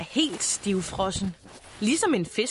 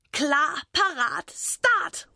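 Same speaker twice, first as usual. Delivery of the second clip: count-off leaping high on the last word; race-start shout; 5 semitones higher, much slower than usual. A narrator's reading. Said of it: "råber Boris". (startråb med højt slutord)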